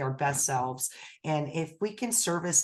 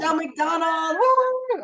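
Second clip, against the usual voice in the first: much higher, louder cheer; excited; 12 semitones higher, 6 dB louder, slower than usual. Real, not acted. Host cheering the next guest's name, thrilled. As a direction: whoop with delight at the name. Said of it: "I'm so excited".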